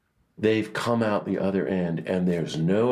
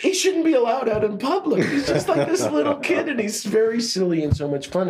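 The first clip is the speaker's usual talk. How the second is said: higher, louder, laughing through the words; 4 dB louder than usual. Real, not acted. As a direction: laugh as you describe him. Delighted memory of Tutu's silliness.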